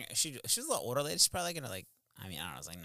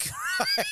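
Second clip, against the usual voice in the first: sudden high laughing yelp; real laugh burst. Real, not acted. Laughing at own story.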